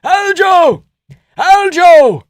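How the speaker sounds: put-on very high, very loud accented shouting; frantic high Georgian coach yelling; Merab impression. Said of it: "That Merab impression".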